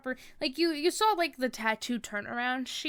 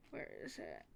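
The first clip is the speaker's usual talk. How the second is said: ordinary talk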